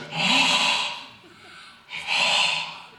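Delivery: long, heavy, voiceless breath; villain's masked breathing. Acted. Actor demonstrates Vader sensing someone, long heavy breaths. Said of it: "and he goes".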